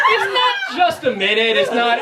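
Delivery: ordinary talk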